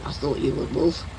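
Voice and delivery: normal talking voice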